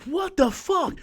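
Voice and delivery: mild disbelieving gasp of words; retold shock. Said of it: "I'm like".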